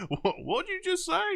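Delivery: very high-pitched incredulous question; mock bafflement. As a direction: imitate baffled people staring at you. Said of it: "people would stare at me and be like".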